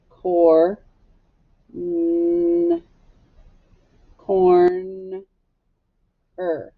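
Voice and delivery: corner sounded out slowly, sound by sound. Shown as a demonstration. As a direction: stretch the n in corner, then a separate er. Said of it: "the er sound".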